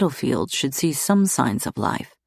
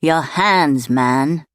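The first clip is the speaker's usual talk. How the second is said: louder, impatient command; 5 dB louder, much slower than usual. A narrator's reading. Clegg repeating his order, impatient and louder. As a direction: snap it out, losing patience. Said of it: "Clegg said impatiently".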